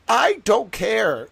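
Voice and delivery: loud, high, drawn-out mock protest; hammed-up stubborn outburst; retold defiance. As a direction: imitate a kid digging in.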